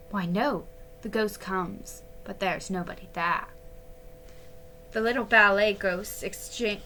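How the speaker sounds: usual speaking voice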